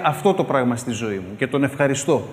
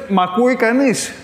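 calling out; 6 semitones higher, 4 dB louder than usual. Retold or imitated. Calling out to be heard.

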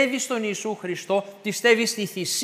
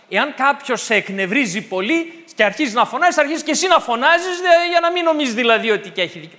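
1 louder, faster; 4 semitones higher, 6 dB louder than usual. Acted. Describing people shouting back.

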